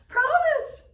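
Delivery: very high, loud squawk; baby raven cry. A narrator's reading. Baby Raven eagerly promises Sky Chief, squawking high. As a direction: shriek it like an eager baby bird. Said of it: "cried Baby Raven".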